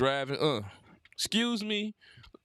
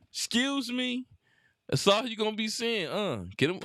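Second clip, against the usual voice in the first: usual speaking voice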